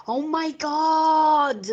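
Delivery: slow, high "oh my God"; exasperated. Real, not acted. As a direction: groan it out, fed up. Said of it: "I couldn't stand that".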